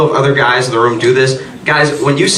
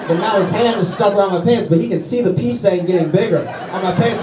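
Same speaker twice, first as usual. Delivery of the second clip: ordinary talk, nothing marked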